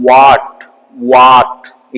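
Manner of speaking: ವಾಟ್ with round lips on w and a long vowel. Shown as a demonstration. Teacher showing this type, round-lipped w in ವಾಟ್. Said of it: "ಈ type pronunciation ಮಾಡ್ತೀವಿ".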